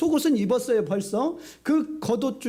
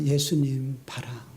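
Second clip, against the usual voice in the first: gentle counsel; 7 semitones lower, slower than usual. Retold or imitated. Pastor counsels the hurt teacher.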